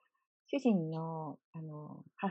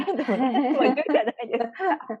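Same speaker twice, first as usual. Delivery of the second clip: laughing; 5 semitones higher, 8 dB louder than usual. Real, not acted. Laughing about elderly mother repeating.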